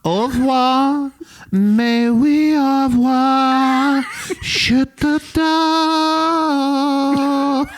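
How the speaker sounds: slow, high mock-French singsong; sing-song French accent. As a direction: croon it in fake French. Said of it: "You're singing".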